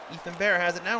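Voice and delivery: ordinary talk, nothing marked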